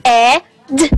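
breathy h, then "e", then "d"; sounding out head. Shown as a demonstration. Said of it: "One more time".

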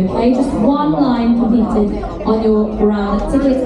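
ordinary talk, nothing marked

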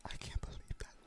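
unvoiced shocked whisper. Real, not acted. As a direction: whisper in disbelief. Horrified aside to Paimon.

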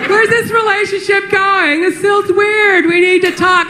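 put-on high, slow girlfriend complaint; whiny girlfriend voice. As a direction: drag it out like a nagging girlfriend.

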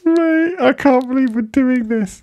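high, giggly disbelief; laughing. Real, not acted. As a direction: talk through the giggles. Still laughing after the sketch.

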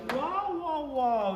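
high, loud wows falling in pitch; wowed by the find, high and loud; amazed wow. Acted. Showing off an ashtray to viewers, amazed.

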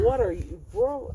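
high, loud mock surprise; mock bewilderment. Telling how his buddy's French shocks him, mock bewildered.

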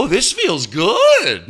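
high, loud, slow gushing moan; mocking guest. Mocking a guest enjoying the towel, a slow loud gush. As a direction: stretch out the pleasure like a gushing guest. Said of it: "Or they'll go".

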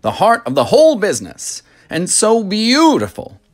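high, lively showman voice; proud factory owner, bright and grand; eccentric chocolatier. Acted. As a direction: sweep the children along with delight. Wonka voice held.

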